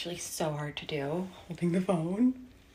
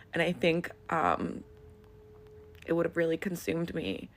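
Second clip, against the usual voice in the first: usual speaking voice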